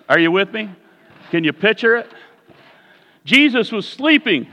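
ordinary delivery

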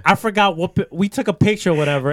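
normal talking voice

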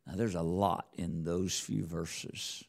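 ordinary talk, nothing marked

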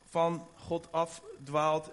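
ordinary talk

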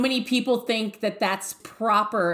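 ordinary talk

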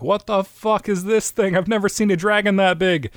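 high, panicked villager voice; shocked villagers. Acted. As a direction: squeak it out like a terrified townsperson. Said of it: "everyone's like".

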